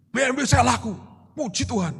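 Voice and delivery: high, eager announcement; enthusiastic. Retold or imitated. Seller phoning a pastor about the sold BMW, enthusiastic. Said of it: "dengan antusias saya bilang".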